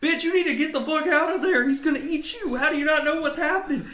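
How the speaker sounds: high, loud, fast shout at the character; yelling at the screen. Retold or imitated. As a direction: scream a warning at the girl in the movie, fast. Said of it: "I'm like".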